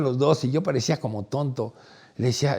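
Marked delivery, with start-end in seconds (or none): none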